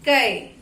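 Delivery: कै loud, with a gliding "ai"; कै said alone, firmly, vowel drawn out; syllable pronunciation demo. Shown as a demonstration. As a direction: sound out कै as a held "kai". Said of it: "हम इसका उच्चारण करेंगे".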